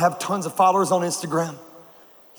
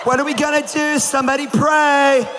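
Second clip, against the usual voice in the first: mock panicked girls; 8 semitones higher, slower than usual. Scared girls at a sleepover crying out, mock panic. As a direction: shriek it like frightened girls calling for help.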